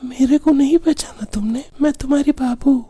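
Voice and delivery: put-on high girlish phone voice; coy young woman voice; man doing a woman's voice. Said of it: "रात में लड़की की आवाज निकालकर".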